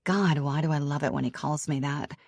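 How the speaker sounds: ordinary delivery, nothing marked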